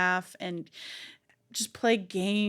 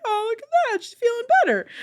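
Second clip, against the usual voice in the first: high, louder gush of joy; delighted; 7 dB louder, much faster than usual. Retold or imitated. Watching her happy inner child, high delighted gush. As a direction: squeal with happiness for her.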